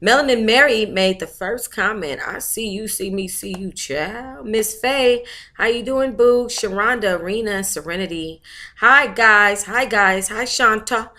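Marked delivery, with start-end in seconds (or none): none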